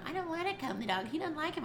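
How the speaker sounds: lightly voiced whining girl; whiny daughter. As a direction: daughter refusing to brush the dog. Daughter whining about chores.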